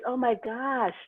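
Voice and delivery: high-pitched mock surprise; retold amazement. Embassy man astonished by his guest's looks, retold surprise.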